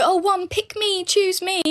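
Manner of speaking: high, slow, eager mock voice; mock pick-me girl. Mocking the eager girl who answers a man's question, high and slow. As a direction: squeak it like a desperate pick-me. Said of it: "I wouldn't turn and go".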